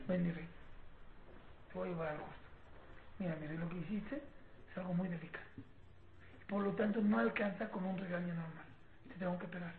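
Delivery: put-on soft, low, gentle explanation to a child; hushed, low fatherly calm; calm parent. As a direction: speak tenderly and gravely, keeping your voice down. Acts calm parent before punishing child.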